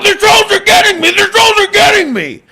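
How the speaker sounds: high, rushed, wailing mock panic; mock freak-out. Streamer playing himself beaten by trolls, hammed-up panic. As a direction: cry it out in fake hysteria. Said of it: "I'm freaking out".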